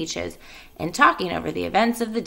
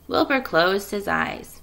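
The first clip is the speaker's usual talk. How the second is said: usual speaking voice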